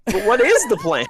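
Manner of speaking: high-pitched, laughing disbelief; exasperated bafflement. Real, not acted. Baffled by the muddled plot.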